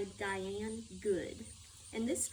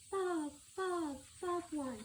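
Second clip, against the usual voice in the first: high, light birdcall imitation; bobwhite call; 8 semitones higher, slower than usual. Narrator mimicking the forest bobwhite, high birdcall. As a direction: call it out like a little bird. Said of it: "A bobwhite whispered in the forest".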